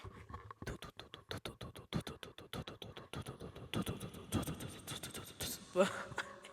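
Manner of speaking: voiceless, quick tongue-tapped "t-t-t" patter; long speeding-up tapping noise with the mouth; imitated rapid footsteps. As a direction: mimic someone hurrying up close behind. Imitates his steps following behind her.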